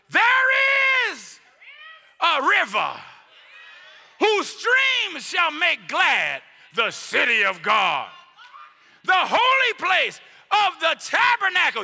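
high, loud, slow emphatic scripture shout; reading with emphasis. Acted. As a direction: proclaim the psalm with full force. Preaching scripture at full force.

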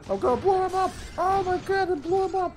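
high-pitched excited shriek; excited squeal. Real, not acted. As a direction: squeal with glee.

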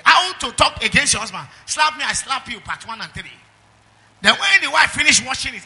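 loud mocking of TV titles; sarcastic loud preaching. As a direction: ridicule the shows at full volume.

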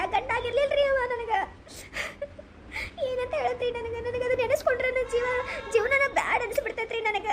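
teary lament to a sympathetic listener; tearful. Acted. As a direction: sob it out as she tells her husband's cruelty. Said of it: "Kallur, ಅಳ್ಬೇಡ್ರಿಪ್ಪ".

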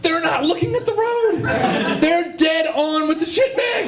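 high, drawn-out gleeful disbelief; high-pitched mock-ecstatic realisation; giddy excitement. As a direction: squeal it slowly, high and thrilled. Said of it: "they're super excited".

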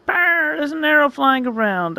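high-pitched startled warning; suddenly alarmed, pitched high; acted alarm. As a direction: sound the alarm, voice jumping up high. Alarm at a vision of danger.

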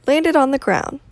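put-on reading to children. Outdoor reading to children, animated storytelling. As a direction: tell it like a bedtime story.

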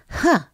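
high, scoffing huh; a cub's sniffy huh; scornful sniff huh. A narrator's reading. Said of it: "sniffed Woof Woof".

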